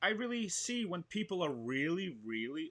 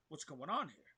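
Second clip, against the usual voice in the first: 1 concerned; 8 dB quieter than usual. Shown as a demonstration. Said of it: "So it's like".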